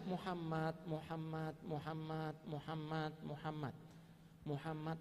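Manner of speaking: hushed, measured repetition of a name; soft repeated calling. Retold or imitated. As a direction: imitate a patient softly calling in prayer. Retold prayer calling the Prophet's name. (menirukan panggilan doa yang lirih berulang)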